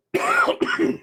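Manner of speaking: put-on loud old man's cough; acted cough. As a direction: cough hard, in character. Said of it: "He coughs".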